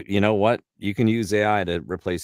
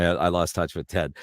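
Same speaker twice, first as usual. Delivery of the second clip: ordinary delivery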